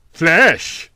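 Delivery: put-on boy relishing the word; slow relish. A narrator's reading. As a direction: savour the word Flesh like a taste. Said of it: "pronounced the word with slow relish".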